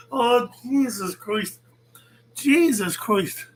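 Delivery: slow, drawn-out groan of disbelief; slow exasperated "Jesus Christ". Real, not acted. Laughing disbelief at rival clip.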